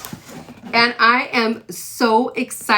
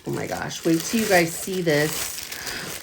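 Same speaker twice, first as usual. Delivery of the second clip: hushed tease; 4 semitones lower, 6 dB quieter than usual. Real, not acted. Teasing the reveal.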